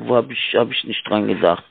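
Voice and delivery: ordinary talk, nothing marked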